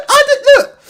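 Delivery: very high, loud, laugh-choked words; words squeezed out through real laughter; fighting laughter. Real, not acted. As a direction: try to get serious while still cracking up. Trying to recover from laughing fit.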